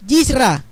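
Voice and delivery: "Yisra" starting with a Y sound, stress on the last a; Hebrew word part. Shown as a demonstration. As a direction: say "Yisra" apart, as yis-RA. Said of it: "la palabra". ("Yisra" con Y inicial, aislada y acentuada al final)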